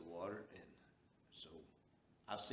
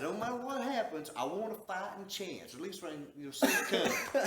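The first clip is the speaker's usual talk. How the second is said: raised retold demand; 9 semitones higher, 9 dB louder than usual. Retold demand to the light holder.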